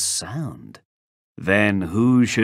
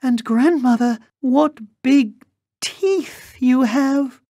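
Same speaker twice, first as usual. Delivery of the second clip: put-on alarmed little girl; 4 dB louder than usual. A narrator's reading. Girl notices the wolf's teeth, high and alarmed.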